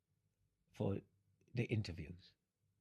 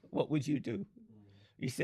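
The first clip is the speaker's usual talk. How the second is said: ordinary delivery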